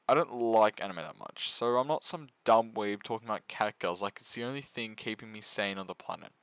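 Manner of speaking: normal talking voice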